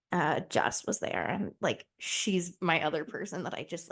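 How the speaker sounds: usual speaking voice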